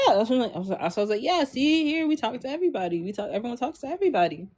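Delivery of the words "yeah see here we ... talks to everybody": high, light, quick reenacted reply; eager reassurance. Telling the new girl everyone talks here, high and quick. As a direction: rattle it off softly, pitched up.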